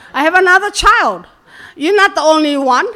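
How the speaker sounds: loud, fast retold objection; mock indignant protest. Mother telling her daughter she's not the only one, mock indignant.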